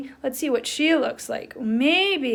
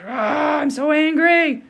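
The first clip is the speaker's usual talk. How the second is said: hammed-up angry cry, louder and higher; mock angry; 5 semitones higher, 7 dB louder, slower than usual. Teacher acting out an angry boy for children, mock angry cry. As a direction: play-act being furious for the kids. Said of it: "Oh my gosh".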